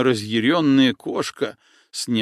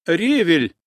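hasty shout; 5 semitones higher, 4 dB louder than usual. Acted. Girl hastily shouting the password to the boat. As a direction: blurt out the password as a quick shout. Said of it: "поспешно выкрикнула девчонка".

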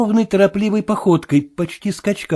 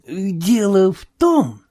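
confiding tone; 2 semitones higher, much slower than usual. A narrator's reading. Marvel starts confiding in the sailor, confiding tone. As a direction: begin slowly, as if letting someone in. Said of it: "начал Марвел доверительным тоном".

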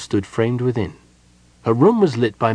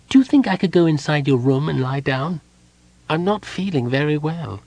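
high, subdued little voice; unwell kender; 6 semitones higher than usual. A narrator's reading. Tass asks to lie down, feeling unwell. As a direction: ask small and high, without shouting.

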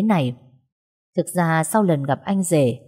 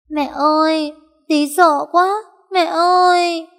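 put-on very high, louder child voice, frightened; a terrified small boy crying to his mum; scared little boy; 11 semitones higher, 4 dB louder, slower than usual. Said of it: "thấy mẹ thì òa lên khóc". (giọng bé trai sợ hãi, rất cao)

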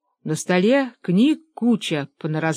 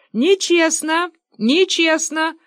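high-pitched cry of protest; Fyodor Kuzmich; 11 semitones higher, 3 dB louder, slower than usual. A narrator's reading. Small fugitive cries foul from under shelves, high protest. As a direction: pipe up in shrieked indignation. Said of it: "крикнул Фёдор Кузьмич".

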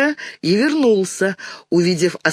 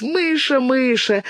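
high teasing sing-out of the nickname; mocking; 9 semitones higher, slower than usual. A narrator's reading. Dinka teases her sister, high mocking voice. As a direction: taunt playfully in a high voice. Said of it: "передразнила она".

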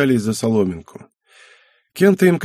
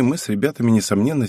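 usual speaking voice

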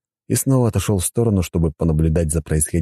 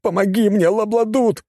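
mild cry upward. A narrator's reading. Man begs a god for help, high mild cry. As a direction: call to the heavens, pitched high. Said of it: "крикнул он куда-то в небо".

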